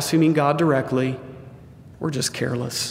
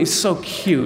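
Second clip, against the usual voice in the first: ordinary talk, nothing marked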